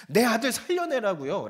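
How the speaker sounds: loud, high cry with 내 hit hard; frantic wail. Acted. Widow crying out to Elijah to bring her son back, loud wail. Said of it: "막 미친 듯이 부르짖는 거예요".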